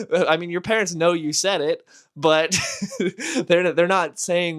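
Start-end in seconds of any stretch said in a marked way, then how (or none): none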